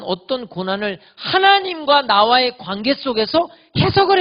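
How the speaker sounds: full-force preacher cry; fervent preaching. Acted. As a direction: drive each line home to the congregation. Preaching climax.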